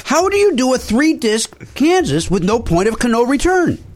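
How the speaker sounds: exasperated. Real, not acted. Outraged over missing song.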